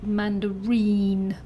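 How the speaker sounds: slow "mandarin", landing on the final "rin"; third-syllable stress. Shown as a demonstration. Teacher gives learners an example, third-syllable stress on "mandarin". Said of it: "or the third syllable".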